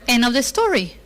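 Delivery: normal talking voice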